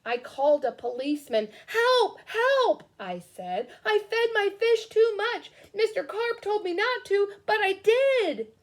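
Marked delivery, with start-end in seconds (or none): 1.7-2.8 cry for help. Acted. Boy calls police for help. 3.8-8.5 put-on a high, fretful child's voice; a kid confessing over the phone, anxious; young boy, worried. Boy phoning a policeman, worried confession.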